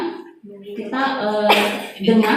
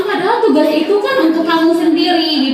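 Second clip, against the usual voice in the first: higher, plaintive guilt-tripping teacher; pleading guilt-trip; 5 semitones higher than usual. Acted. Teacher reproaching a student over undone homework, pleading guilt-trip. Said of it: "nada suara memelas".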